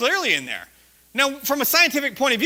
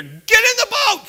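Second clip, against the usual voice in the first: high, slow shouted order; retold scream; 12 semitones higher, 7 dB louder than usual. To the girl half in the tube, a frantic shout. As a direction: yell it like the lightning is coming. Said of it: "I screamed at her".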